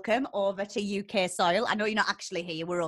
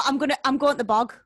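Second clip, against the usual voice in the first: loud, quick, higher Geordie demo; Geordie accent; 5 semitones higher, 8 dB louder, faster than usual. Shown as a demonstration. Geordie host showing the slang in use, loud quick accent in Geordie. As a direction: rattle it off in Geordie. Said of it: "So you go".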